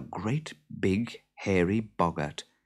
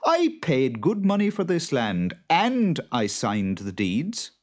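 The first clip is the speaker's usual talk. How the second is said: raised, louder protest; indignant farmer; 7 semitones higher, 4 dB louder than usual. A narrator's reading. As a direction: argue back firmly, starting high and loud. Farmer argues back.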